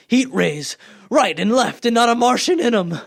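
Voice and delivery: rising to a loud, high, fevered cry; fevered excitement. Acted. Artilleryman shouting his dream of stolen war machines, fevered. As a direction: swept away by his own vision, shouting it out.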